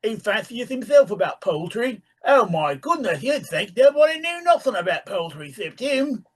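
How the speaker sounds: high rustic British voice; grumbling old carpenter voice; country accent. A narrator's reading. Reads the carpenter's dialogue.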